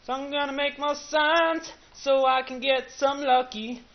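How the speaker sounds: slow, high, loud silly voice; hammed-up high voice.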